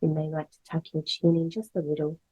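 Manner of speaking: ordinary delivery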